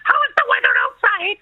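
put-on high sing-song Kerry country accent; Cloughlin accent. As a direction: lilt it up high like a Cloughlin caller. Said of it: "they say to you".

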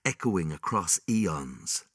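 ordinary delivery

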